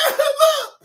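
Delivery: high, loud call for attention; excited call. Real, not acted. Grabbing Lance's attention, high excited call.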